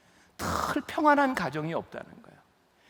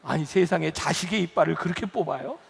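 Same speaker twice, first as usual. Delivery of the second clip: usual speaking voice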